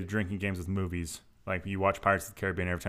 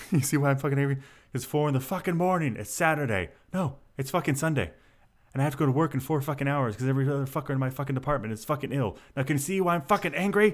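mock angry rant; 6 semitones higher than usual. Neighbour yelling about loud music.

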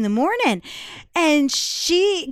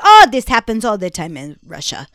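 Russian roommate; 8 dB louder than usual. Retold or imitated. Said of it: "She goes".

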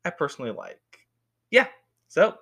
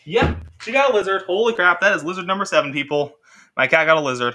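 excited exclamation; 3 semitones higher, 9 dB louder than usual. Real, not acted. Cat brings in a lizard.

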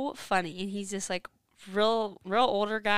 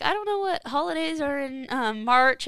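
lightly voiced old man, higher and louder; funny old man; 6 semitones higher, 6 dB louder than usual. Older customer lingering on the phone, lightly imitated old man. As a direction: imitate a chatty elderly caller, pitched up. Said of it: "talks really slow and funny".

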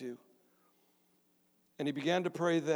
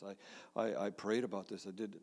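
ordinary talk

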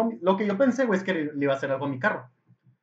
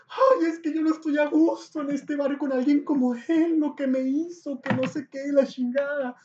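high, slow imitated whine; mock crying; 9 semitones higher, slower than usual. Woman complains to policewoman about neighbor, mock sobbing complaint. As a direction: whine tearfully, dragging it out. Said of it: "empieza ya a llorar".

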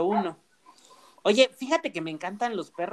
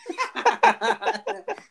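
sudden high laugh; high burst of laughter. Real, not acted. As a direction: break into a high giggle. Laughs at the neighbour remark.

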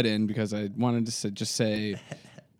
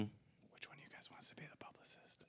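whispered aside; whispering; 3 semitones lower, 29 dB quieter than usual. Real, not acted. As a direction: whisper it off-mic. Aside while on fake call.

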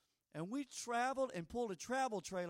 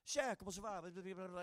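Cajun French greeting; 6 dB quieter than usual. Retold or imitated. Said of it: "and they're like".

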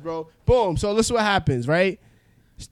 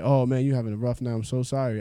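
low, soft sympathy; sympathetic; 6 semitones lower, 5 dB quieter than usual. Retold or imitated. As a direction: console him gently. Sympathetic cab driver voiced.

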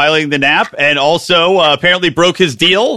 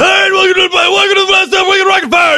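Johnny Rocket impression; 13 semitones higher than usual. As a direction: yell it like an overhyped show host. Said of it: "You gotta be more guttural".

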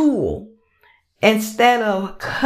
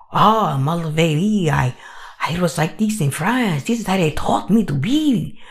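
hammed-up French cartoon skunk; bad French accent; 4 semitones lower than usual. Pepe explaining how he was raised, bad French accent. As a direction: put on a rough French accent. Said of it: "I don't have a good French accent voice yet".

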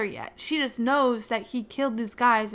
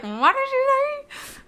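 squeaky, much higher, slow incredulous meme voice; meme Vine voice; 5 dB louder, slower than usual. Retold or imitated. Quoting a meme Vine while retelling, high and slow. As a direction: stretch it out like the viral Vine. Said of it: "I love that meme Vine".

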